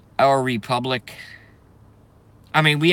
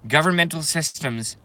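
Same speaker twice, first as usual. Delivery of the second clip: normal talking voice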